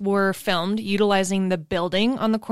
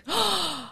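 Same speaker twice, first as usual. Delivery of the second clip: dramatic gasp; 10 semitones higher, 6 dB quieter than usual. Acted. Shock at lost disc.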